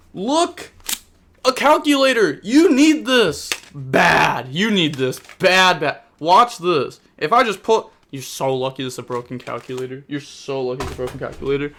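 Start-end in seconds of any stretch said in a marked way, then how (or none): 0.1-7.9 high, loud mocking rant; loud sarcastic tirade at the misplay; furious rant. Real, not acted. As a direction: jab at the player in real anger. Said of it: "I'm actually getting so mad".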